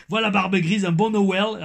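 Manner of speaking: high, loud mock Québécois accent. Reading a fan's Christmas wishes, hammed-up Québécois accent. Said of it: "Mon accent québécois".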